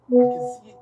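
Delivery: loud, high burst of delight; excited exclamation. Real, not acted. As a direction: cry it out in real wonder. Marveling at the man's photo.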